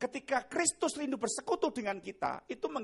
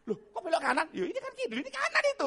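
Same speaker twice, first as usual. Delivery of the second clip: exasperated mock protest; 8 semitones higher, 4 dB louder, faster than usual. Husband corrects wife's wrong turn at the wheel, exasperated high protest. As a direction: voice leaping high in dismay.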